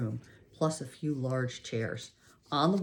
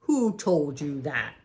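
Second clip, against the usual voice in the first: scowling; 6 dB louder, slower than usual. A narrator's reading. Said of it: "squirmed up into a scowl".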